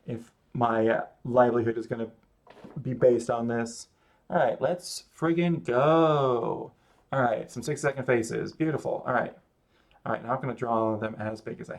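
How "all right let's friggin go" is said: slow, drawn-out pump-up; rally yourself to start; hyped. Real, not acted. Starting the drawing warm-up, hyped. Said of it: "hyping self up".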